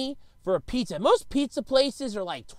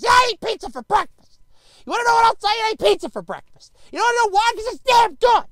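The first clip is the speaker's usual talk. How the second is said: put-on defiant, loud and higher kid retort; defiant kid talking back; 10 semitones higher, 6 dB louder, faster than usual. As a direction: shout it back at the teacher, cocky. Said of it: "got yelled at".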